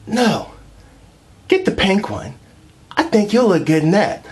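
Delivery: high, sweet mock girlfriend. Girlfriend voiced in a bit.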